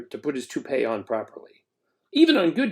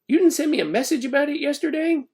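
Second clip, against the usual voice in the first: confused Ben; 13 semitones higher than usual. A narrator's reading.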